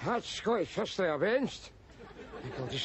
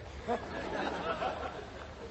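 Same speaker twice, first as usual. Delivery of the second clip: soft wheezing laugh; 4 semitones higher, 6 dB quieter than usual. Acted. Laughs at his own joke.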